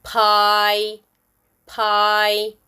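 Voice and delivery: "pie" with a held "eye" vowel; long I. Shown as a demonstration. Teacher gives example words, long I. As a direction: draw out the end of "pie". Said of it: "words with long I sound".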